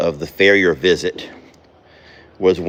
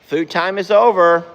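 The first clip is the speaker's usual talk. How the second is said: calling out; 8 semitones higher, 3 dB louder than usual. Real, not acted. Repeated call to horses.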